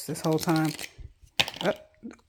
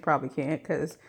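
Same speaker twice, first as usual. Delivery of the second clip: ordinary delivery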